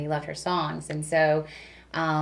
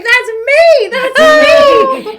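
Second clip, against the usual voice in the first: shouting it out much higher and louder, laughing and near tears; excited shout; 17 dB louder, much slower than usual. Retold or imitated. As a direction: yell it like you just heard yourself on the radio. Shouting she's the caller on air.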